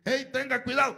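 mock warning shout; called out.

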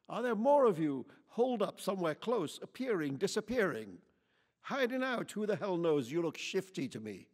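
loud, high, building hostile rant; angry tirade. A narrator's reading. Lady interrogates Quichotte about where he's from, furious. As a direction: pile accusations on him.

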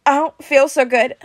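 slow, high, struggling plea; frightened kid getting worse; distressed. A narrator's reading. Peter in allergic reaction.

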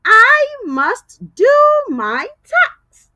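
slow, loud sentence, leaning on do; slow model sentence. Shown as a demonstration. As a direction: say it slowly so kids can repeat. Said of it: "let's use it in a sentence".

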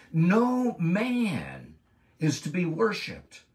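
high, loud, fervent preacher's voice; full-force preaching. Acted. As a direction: preach it out, rising in pitch and volume.

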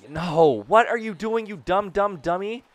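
loud, high yell at a blunder; exasperated yell. Real, not acted. Player yelling at his fielder's blunder, exasperated. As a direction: scold the dumb dummy.

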